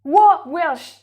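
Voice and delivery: loud, high-pitched astonishment; real surprise. Real, not acted. Guesser hearing that the answer is Welsh, astonished.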